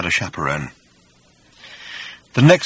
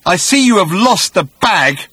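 vengeful creature; 10 semitones higher, 7 dB louder than usual. A narrator's reading. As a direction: taunt him from above. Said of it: "said the voice".

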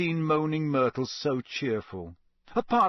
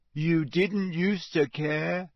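slow, higher, miserable moan; 5 semitones higher, much slower than usual. Acted. Neglected ghost reproaches Harry, miserable. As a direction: draw it out, wounded and self-pitying. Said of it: "said Myrtle miserably".

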